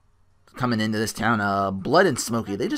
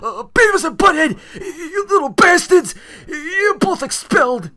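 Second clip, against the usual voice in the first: much higher stuttering school principal, loud and scolding; a stammering principal yelling at his pupils, pitched way up; John Laurinaitis voice; 7 dB louder than usual. Retold or imitated. Voicing Smokey as a stuttering principal expelling Beavis and Butthead, high and loud. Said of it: "one stuttering principal from Beavis and Butthead".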